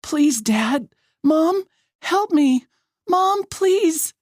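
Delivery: high, drawn-out cries for help; high-pitched, desperate plea; desperate begging. A narrator's reading. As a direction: beg for rescue in panic. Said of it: "I beg her".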